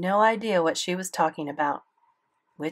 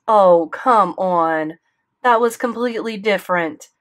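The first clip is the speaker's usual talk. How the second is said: dismissive; 3 semitones higher, 9 dB louder than usual. A narrator's reading. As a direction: wave her off like nonsense. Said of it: "answered dismissively".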